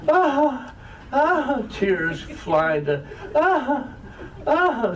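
hammed-up bawling in bursts; put-on hysterical crying. Son mimicking his mother who could really put it on, mock hysterical wailing. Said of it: "my mother begins crying hysterically".

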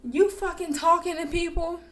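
high, sharp jealous shout; jealous boyfriend confronting her in the hallway, angry and high; angry accusation. Retold or imitated. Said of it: "All I hear is".

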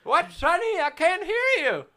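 put-on high cracked old-man voice; deaf old grandpa. Mocking the oldest host as a deaf old grandpa.

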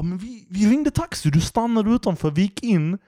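high falsetto bewildered whine; puzzled drunk woman asking who pays, in a squeaky high voice; confused drunk woman. Retold or imitated. Mimicking the confused drunk passenger, high falsetto. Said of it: "Hon bara".

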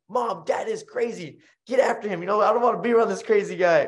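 put-on high kid's complaint to mom; mock-whining son running to mom; boy complaining to mom about dad; whiny kid.

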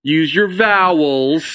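very slow, drawn-out vowels; slow coaching. Shown as a demonstration. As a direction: stretch each word, especially "vowels". Coaching the word slowly.

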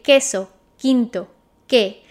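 'Queso' said as késo, U silent; 'quinto' with the U not pronounced, kinto. Shown as a demonstration. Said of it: "See how we don't pronounce the U?".

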